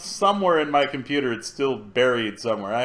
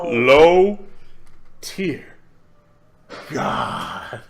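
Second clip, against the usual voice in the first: drawn-out groan of a name; much slower than usual. Real, not acted. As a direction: drag each word out with long gaps. Drawn-out exasperated name of streamer.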